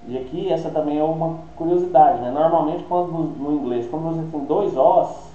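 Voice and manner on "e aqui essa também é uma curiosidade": normal talking voice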